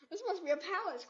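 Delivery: a touch of breathless awe; gasping in wonder. Acted. Bear marvels at the store, mild gasp. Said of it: "Corduroy gasped".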